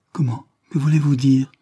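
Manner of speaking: understated flustered tremble; trembling stammer. A narrator's reading. Barbara learns of the gift from London, mildly flustered and trembling. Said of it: "balbutia-t-elle en tremblant".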